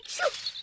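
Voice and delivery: high sudden sneeze; a sharp little achoo. Acted. Sneezing spell hits Tessie.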